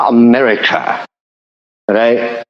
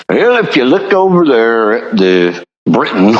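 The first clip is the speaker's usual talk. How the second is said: mock American politician. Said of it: "that's how they say it".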